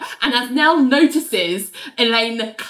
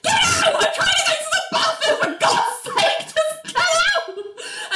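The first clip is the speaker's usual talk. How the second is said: very high hammed-up shriek; mock furious screeching. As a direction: act out screeching someone out of the bathroom. Nell screams at John to leave.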